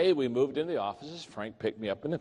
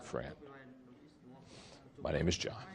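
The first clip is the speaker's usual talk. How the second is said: gentle confiding; 2 semitones higher, 11 dB quieter than usual. Retold or imitated. Arm around him, gentle.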